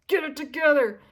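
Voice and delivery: loud, high order to yourself; stern self-scolding. Retold or imitated. Telling herself to stop crying, loud scolding. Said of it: "I told myself".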